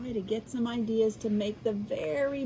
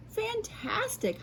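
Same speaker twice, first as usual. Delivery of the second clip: much higher, slow marvelling; amazed exclamation; 13 semitones higher, slower than usual. Acted. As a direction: stretch it out in wonder. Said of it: "Hoppy exclaimed".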